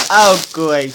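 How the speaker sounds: ordinary talk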